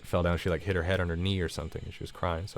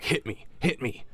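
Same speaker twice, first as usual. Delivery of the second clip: mock whisper; 4 semitones higher, 3 dB quieter than usual. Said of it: "whispering in my ear".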